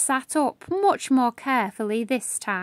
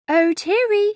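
high, louder call to the device; 8 semitones higher, 4 dB louder than usual. Acted. Madame Miraculous calling her voice device, high and louder. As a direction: call across the lab to Tiri. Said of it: "she called out".

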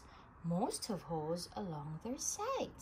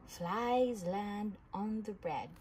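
ordinary delivery, nothing marked

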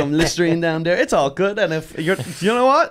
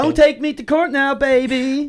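mock [name] plea; 9 semitones higher, 3 dB louder than usual. [name] pleading in song.

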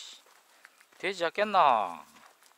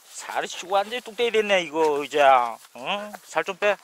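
higher, louder playful scolding; teasing dogs; 5 semitones higher, 6 dB louder than usual. Real, not acted. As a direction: tease the chubby dogs.

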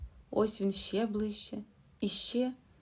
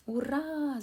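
high happy little hooray; joyful cheer; 6 semitones higher, 3 dB louder than usual. A narrator's reading. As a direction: cheer for the find. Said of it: "зрадів Тімі".

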